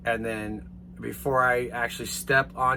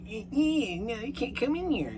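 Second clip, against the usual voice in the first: mocking flight attendant; 10 semitones higher, 3 dB quieter than usual.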